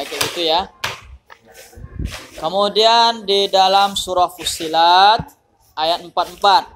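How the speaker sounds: usual speaking voice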